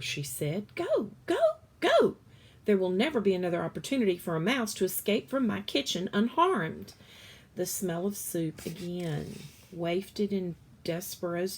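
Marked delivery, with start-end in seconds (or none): 0.7-2.2 high, loud urgent go go; urgent shout. Acted. Cook shooing the mouse out, high loud urgent shout. 2.6-6.9 hurried urging. Acted. Cook urging mouse.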